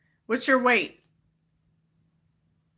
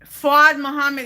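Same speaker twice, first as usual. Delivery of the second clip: loud, higher call of a name; 5 semitones higher, 7 dB louder than usual. Real, not acted. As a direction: call him out firmly by name. Calls out the commenter by name.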